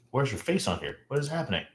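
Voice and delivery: ordinary talk, nothing marked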